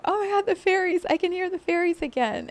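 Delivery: high, quick burst of delight; joyful excitement. Retold or imitated. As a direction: gasp it out like a reunion. Heart rejoicing at hearing fairies.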